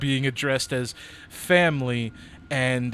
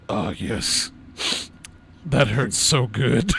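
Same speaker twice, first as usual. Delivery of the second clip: pleasured groan; 4 dB louder, slower than usual. Acted. GM voices Shakar eating ice cream.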